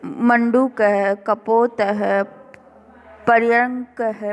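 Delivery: each word spoken slowly and clearly; slow careful word reading. Shown as a demonstration. Teacher pronouncing picture words slowly for the class. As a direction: drag each word out for pupils. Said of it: "उच्चार्यात मतलब बोलना ही है आपको".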